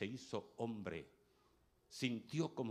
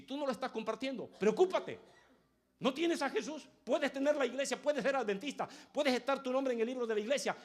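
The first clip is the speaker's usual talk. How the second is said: high, loud, driving sermon voice; fiery preaching; 13 semitones higher, 10 dB louder than usual. Acted. Preacher warning churchgoers who don't share Jesus, loud and high. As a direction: hammer the warning home from the pulpit.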